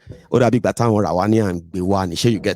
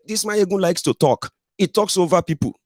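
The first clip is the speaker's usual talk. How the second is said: higher-pitched mock voice of a complaining listener; mimicked complaint; 9 semitones higher than usual. Host mimics listeners who say he talks over people, mock complaint. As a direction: imitate a critic grumbling in a raised pitch. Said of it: "and you feel like".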